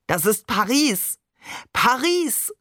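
slow exclamation, leaping high on the repeat; exultant call. A narrator's reading. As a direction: proclaim the city with delight. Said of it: "rief Babe".

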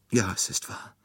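high, tearful admission. A narrator's reading. Nurse confesses to detective, still tearful. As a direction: admit it through held-back tears. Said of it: "noch immer weinend".

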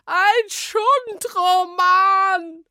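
very high, loud, slow shriek; screeching. A narrator's reading. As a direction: shriek in disgust.

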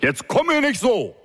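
a police chief exploding at his officer over the phone. A narrator's reading. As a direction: blow up at her. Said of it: "deutlichen Anstieg der Lautstärke".

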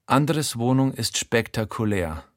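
ordinary delivery

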